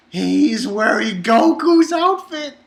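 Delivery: loud, slow, incredulous stress; disbelieving, drawn-out exclamation; amazed emphasis. Real, not acted. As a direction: spell out the absurdity, slowly and loud. Marvelling at the priest's costume.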